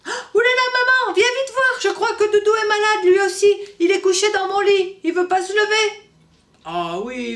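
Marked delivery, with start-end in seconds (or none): 0.1-6.1 put-on very high, loud child voice; a child calling urgently about his toy; excited little boy. Tchoupi calling maman about his sick Doudou, high and excited.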